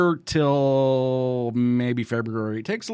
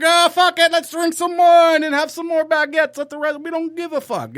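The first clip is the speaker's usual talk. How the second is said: much higher, louder mock French partygoer; mock carefree French; 12 semitones higher, 5 dB louder than usual. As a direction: lampoon the French as carefree wine drinkers, pitched way up. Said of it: "They're like".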